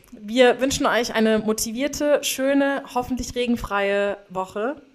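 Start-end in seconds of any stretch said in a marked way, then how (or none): none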